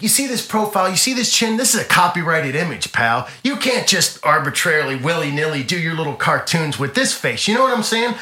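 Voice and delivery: hammed-up indignation, pressing each point; mock indignant star. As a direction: imitate a star lecturing an upstart. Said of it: "he goes like this".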